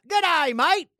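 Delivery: high, loud Aussie greeting; mock Australian accent. Greeting Australian listeners, mock Australian accent. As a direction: holler it like a cartoon Australian.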